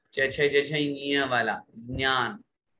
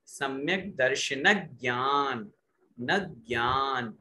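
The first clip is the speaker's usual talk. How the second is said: slow दर्शन ज्ञान with the न pressed before ज्ञान; stress before ज्ञान; slower than usual. Shown as a demonstration. Teacher showing the stress before a conjunct. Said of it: "उस पे जोर देना पड़ेगा".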